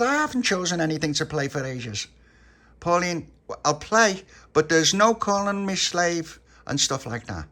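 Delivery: put-on high, loud childish objection; acted huffy bargaining; indignant protest. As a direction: argue back at full pitch. [name] protests, sets terms to Pauline.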